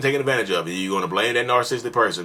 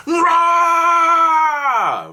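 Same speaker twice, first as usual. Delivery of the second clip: sudden high roaring yell, much louder; rage monster roar; 8 dB louder than usual. Retold or imitated. Spoiled person told no, roaring yell. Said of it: "Rage monster".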